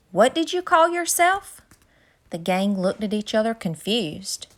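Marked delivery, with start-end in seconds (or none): 0.1-1.6 angry. A narrator's reading. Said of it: "Cocky stood up angry".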